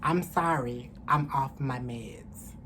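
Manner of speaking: usual speaking voice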